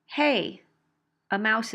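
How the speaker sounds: alarmed "Hey" calling for attention; alerting hey. Shown as a demonstration. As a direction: call out to warn someone. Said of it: "to say it another way".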